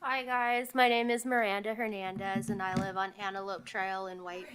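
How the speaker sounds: speaking far too softly, barely audible; almost inaudible, as if away from the mic; soft, mousy voice nobody can hear; too quiet. Real, not acted.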